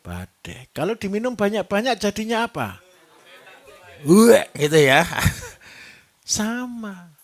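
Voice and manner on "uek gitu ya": mock retching. Mimics drunk vomiting.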